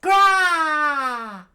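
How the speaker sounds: long high-pitched screamed roar. A narrator's reading. Giant cries out as he is bitten, screamed roar. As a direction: scream it out very loud and hold it. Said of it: "he screamed out".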